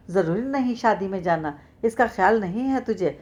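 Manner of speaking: ordinary delivery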